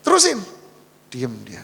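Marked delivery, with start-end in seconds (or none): 0.0-0.4 high, fast scolding director; a bossy woman nagging from the back seat; nagging boss. Retold or imitated. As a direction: snap at the driver to keep going. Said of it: "Si ibu udah mulai ngomel".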